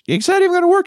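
put-on a high, squeaky kid doubting the plan. Kid scoffing at his friend's plan, high squeaky voice. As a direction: pitch way up like a skeptical child.

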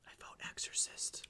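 whisper. Acted. Dramatic whispered vote.

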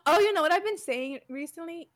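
usual speaking voice

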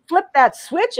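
normal talking voice

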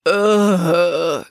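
big drawn-out groan of exhaustion; heavy groan. A narrator's reading. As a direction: groan from a stuffed belly. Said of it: "groaned Derek".

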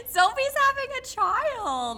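high, slow, thrilled reveal; excited announcement. Real, not acted. Big surprise pregnancy announcement.

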